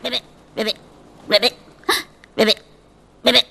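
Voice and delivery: soft low ribbits; frog croak. Pretend. Tamba playing the frog Simon, soft croaks. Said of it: "I'm pretending to be Simon, who's been turned into a frog".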